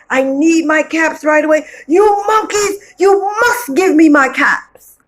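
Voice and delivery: high, loud desperate shout; frantic demand. Acted. Peddler pacing under the tree, shouting for his caps. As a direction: yell up in rising frustration. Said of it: "he walked and he paced and he said".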